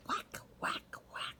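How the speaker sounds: soft breathy whack whack whack; quiet rhythmic chop sounds; axe whacks. A narrator's reading. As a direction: mimic the axe chopping softly.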